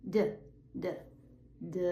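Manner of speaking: repeated buzzing D; voiced D sound. Shown as a demonstration. Teacher isolating the voiced D. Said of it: "You're using your voice".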